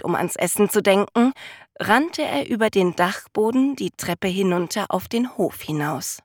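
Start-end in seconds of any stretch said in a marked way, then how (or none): none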